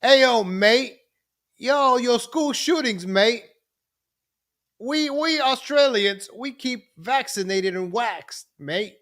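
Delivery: high, slow, stretched-out mock Australian; hammed-up Aussie accent, far above own pitch; badly faked Australian, drawling the words. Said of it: "I can't do the Australian accent".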